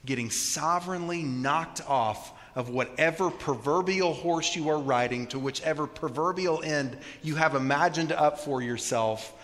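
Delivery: normal talking voice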